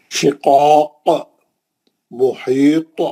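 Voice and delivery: slow, drawn-out recitation with a bouncing qalqala at the end; tajweed recitation, strong qalqala on the final letter; Quran recitation. Shown as a demonstration. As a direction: recite it slowly and let the last letter echo. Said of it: "अच्छा खलखला करना".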